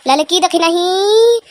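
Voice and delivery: mock wailing summons; whiny call. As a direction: wail her name in despair. Calling out, exasperated.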